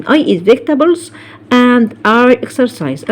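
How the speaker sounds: slow, deliberate English example; careful dictation pace; slow model sentence. Shown as a demonstration. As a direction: read it slowly for students. Teacher models example sentence slowly.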